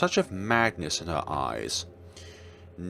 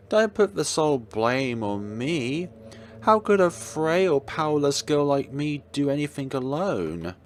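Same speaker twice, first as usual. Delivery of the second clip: high, coy and teasing; mock innocence; 7 semitones higher than usual. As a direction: play the helpless girl. Villain feigns innocence.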